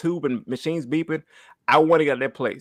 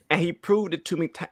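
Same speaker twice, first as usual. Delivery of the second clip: ordinary delivery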